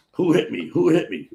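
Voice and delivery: mock belligerent shout; indignant demand. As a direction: imitate a man demanding to know who hit him. Retold dazed man demanding attacker.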